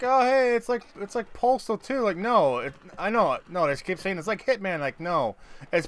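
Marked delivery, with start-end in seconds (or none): 0.0-2.0 high, mocking voice of clueless gamers; mocking imitation. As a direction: parrot them in a higher, silly tone.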